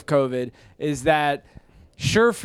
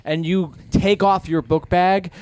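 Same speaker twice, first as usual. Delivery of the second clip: usual speaking voice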